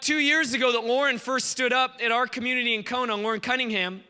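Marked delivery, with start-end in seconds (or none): none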